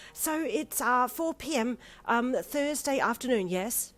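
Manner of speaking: a dithering lady booking a time; nervous flustered lady. A narrator's reading. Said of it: "nervously agitated tone".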